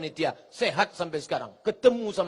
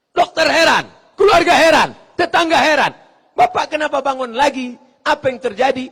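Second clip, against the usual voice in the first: higher and much louder exclamations; astonished shout; 8 semitones higher, 12 dB louder than usual. Acted. Preacher tells how everyone marveled at revived man. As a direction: cry out in amazement.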